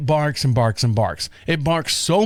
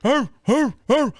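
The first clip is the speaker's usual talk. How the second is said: high mock dog barks; short woofs imitating a big dog; imitated dog bark; 9 semitones higher, slower than usual. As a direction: do the dog barking back. Imitates the dog barking between yells.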